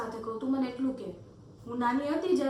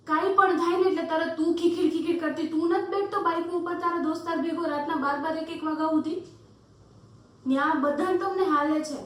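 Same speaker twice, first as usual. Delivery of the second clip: angry accusation; 3 semitones higher, 3 dB louder than usual. Acted. Girl calling out his late-night double standard, indignant. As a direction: throw the accusation back at them, heated.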